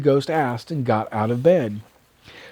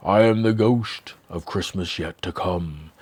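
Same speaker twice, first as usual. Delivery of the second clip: much lower, softer doom-laden voice; ominous ghost; 9 semitones lower, 5 dB quieter than usual. Acted. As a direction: speak like death itself.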